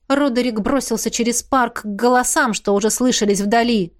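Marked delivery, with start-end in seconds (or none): none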